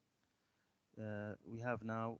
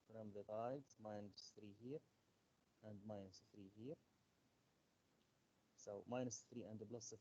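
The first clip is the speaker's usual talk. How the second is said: softly spoken teacher explanation; low voice; 9 dB quieter than usual. Real, not acted. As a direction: explain it softly, well under normal volume. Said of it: "your voice is so low".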